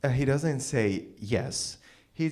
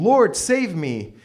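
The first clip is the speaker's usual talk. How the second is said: mock scream; 6 semitones higher, 5 dB louder, slower than usual. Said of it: "Peter is screaming".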